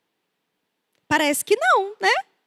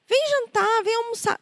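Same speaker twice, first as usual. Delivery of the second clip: gentle, higher-pitched coaxing; loving mother; 6 semitones higher than usual. Retold or imitated. Mother calling her son to bath and dinner, lovingly. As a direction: call your child over lovingly, softening after the first word. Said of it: "tudo com amor".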